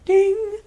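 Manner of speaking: high sung ding like a bell. Pretend. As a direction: chime it out like a game show bell. Mock bell after a thumbs up.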